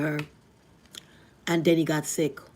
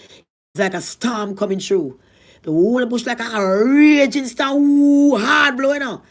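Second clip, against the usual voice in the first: builds to a loud high cry at the storm; storm rising; 6 semitones higher, 10 dB louder, slower than usual. Retold or imitated. Telling how the storm hit the grave, a rising loud cry. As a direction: swell up like the wind.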